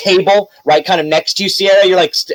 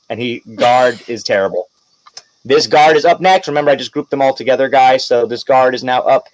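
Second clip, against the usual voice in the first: ordinary delivery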